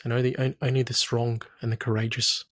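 ordinary talk, nothing marked

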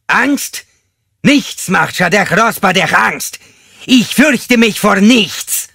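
high, slow, full-force roar; defiant bellow. Acted. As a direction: roar it proudly into the listener's face. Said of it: "sondern brüllte ihr ins Gesicht".

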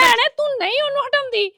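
high, heated complaint; indignant protest. Acted. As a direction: flare up at your sister-in-law. Quarrel, protesting to sister-in-law.

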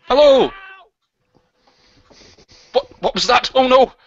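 loud, high startled cry; startled exclaim. Acted. Host startled by a guest's noise, loud high alarm. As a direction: jump at the sudden noise.

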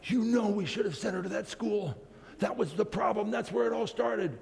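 a hint of a distraught mother blaming; mother shouting. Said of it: "the mother was shouting at him".

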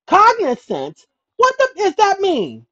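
high, loud, drawn-out baffled cry of Cognizant?; baffled listener. Pretend. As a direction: play a stunned listener who can't believe the word. Mock confused listener asks meaning.

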